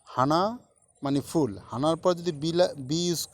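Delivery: ordinary talk, nothing marked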